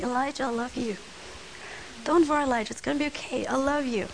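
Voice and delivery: soft, tender imagined voice of God; a hushed loving reassurance; gentle whisper. Pretend. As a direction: speak it softly like a loving whisper. God's imagined whisper to Elijah.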